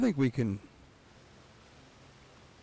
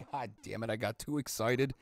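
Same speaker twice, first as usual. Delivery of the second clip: ordinary talk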